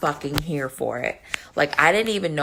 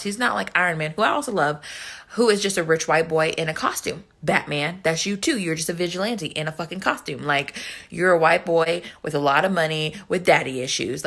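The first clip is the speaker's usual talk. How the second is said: ordinary delivery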